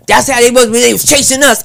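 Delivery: loud, high defensive shout; heated retort. Acted. Kid answering the comic's challenge, heated retort. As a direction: argue back at full voice. Acted street argument being retold.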